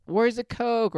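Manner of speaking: high-pitched mock party squeal; screaming party people. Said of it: "People screaming".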